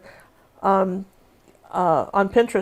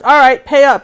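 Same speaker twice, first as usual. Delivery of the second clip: loud, high, cocky pay up; gloating demand; 5 semitones higher, 11 dB louder than usual. Retold or imitated. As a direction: call in the bet with glee. Ex collects his side bet.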